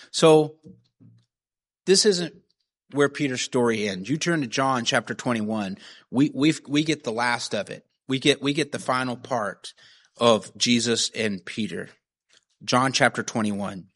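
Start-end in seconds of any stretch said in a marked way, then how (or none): none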